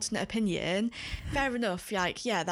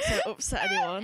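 mock air quotes. Air quotes, mocking phrase.

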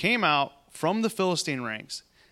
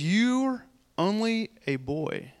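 drawn-out, higher-pitched belittling; slow put-down; 5 semitones higher, slower than usual. Acted. Saul telling David he cannot fight Goliath, slowly dismissive. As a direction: stretch it out, talking down to a youngster.

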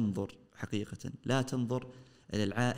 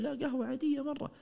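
viel höher, leicht spöttische Kundenstimme; nachgeäffter Kunde. Retold or imitated. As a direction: den Kunden hoch und naiv nachäffen. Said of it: "قال".

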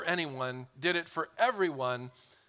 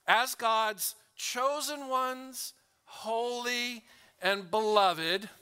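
"as God's chosen ones, holy and beloved" drawn out slowly, pitched higher; very slow reading; 6 semitones higher, much slower than usual. Shown as a demonstration. Preacher modelling slow reading of the verse to the church. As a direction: savour each word, stretched out. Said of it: "Let's read these words slow".

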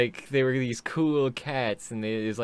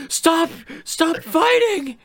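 very high, loud, drawn-out wail; hammed-up shriek to break up a fight; slow falsetto holler, played for laughs; mock pleading shout; 10 dB louder, slower than usual. Listener playacts breaking up the fight.

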